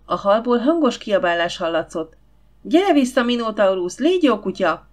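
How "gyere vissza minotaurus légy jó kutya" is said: high, loud calling yell; yelling after the dog. A narrator's reading. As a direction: call the dog back from the top of the stairs. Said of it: "süvöltötte Hugh".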